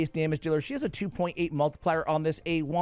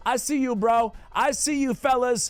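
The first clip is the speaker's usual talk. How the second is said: hyped bro shout; 9 semitones higher, 4 dB louder than usual. Pretend.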